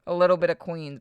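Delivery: normal talking voice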